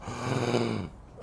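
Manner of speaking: sharp, put-on gasp; imitated shocked gasp. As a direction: gasp like a gawking crowd. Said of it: "like, gasping".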